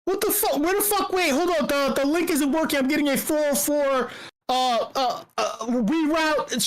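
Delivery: high, frantic mock panic; panicked crowd. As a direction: play the confused crowd losing it. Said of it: "everyone's like".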